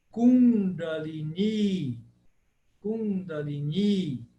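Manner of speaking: Kundalini slowly, second i held long; Kundalini short then long i. Shown as a demonstration. Teacher modelling Kundalini for students. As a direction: stretch the last i of Kundalini. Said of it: "Por isso que se fala".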